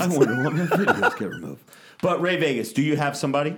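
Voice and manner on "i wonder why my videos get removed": laughing. Real, not acted. Repeats the line laughing.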